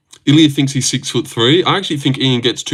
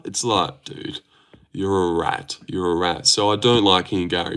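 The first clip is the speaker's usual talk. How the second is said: usual speaking voice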